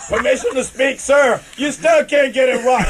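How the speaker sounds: ordinary talk, nothing marked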